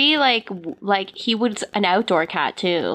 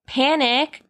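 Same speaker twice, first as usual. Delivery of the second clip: usual speaking voice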